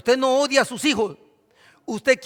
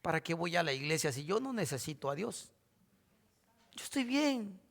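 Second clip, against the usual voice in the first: self-satisfied churchgoer; 6 semitones lower, 15 dB quieter than usual. Retold or imitated. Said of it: "Usted se levantaría un domingo y diría".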